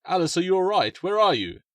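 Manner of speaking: high, loud worried call; a father calling for his daughter. Acted. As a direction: shout down to find her.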